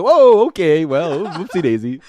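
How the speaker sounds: high, loud mock on-air fluster, laughing; flustered TV presenter. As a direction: imitate a startled host covering a slip. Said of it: "and they cut back".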